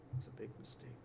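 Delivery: whispered ominous warning. Pretend. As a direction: hush it with dread.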